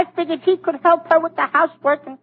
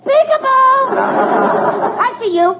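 playful peekaboo; 8 semitones higher, much slower than usual. Acted. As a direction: sing it out like playing with a baby. Mistakes blouse for a children's game.

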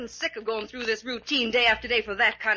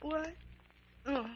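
groggy waking; 3 semitones higher, 9 dB quieter than usual. Acted. Medium waking from trance.

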